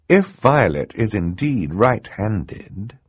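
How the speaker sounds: deliberate woman judge; careful. Acted. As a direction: weigh each word carefully. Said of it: "she said carefully".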